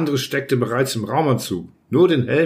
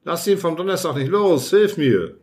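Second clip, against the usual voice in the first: urgent plea, pitched up; mildly raised, pressing cry; calling out; 5 semitones higher than usual. A narrator's reading. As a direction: call for help mid-struggle. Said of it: "rief der im Skapander".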